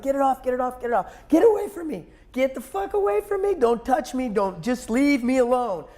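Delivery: high, strained cries to get away; panicked pleading. Retold or imitated. Injured man pushing the EMT away, high panicked pleading. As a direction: beg them to stop touching you. Pain from blood pressure cuff.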